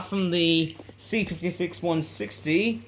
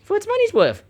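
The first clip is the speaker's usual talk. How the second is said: gleeful build to a squeal; rising excitement; 8 semitones higher, 4 dB louder than usual. Real, not acted. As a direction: pitch climbs way up, louder. Build-up to the train run.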